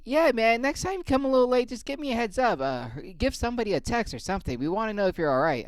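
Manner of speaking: very high nagging coworker voice; mocking coworker. Coworker scolding him for lateness, high mocking whine.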